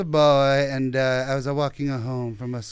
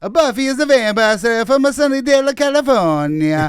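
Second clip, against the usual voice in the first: higher and louder in a hammed-up Italian accent, every "uh" kept; 8 semitones higher, 10 dB louder than usual. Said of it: "I can't do it in the Italian accent".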